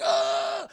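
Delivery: high, loud imitated yelp of pain; hammed-up agonized "ugh"; mock pained groan. Recapper acts Bruce being tased, mock pained cry.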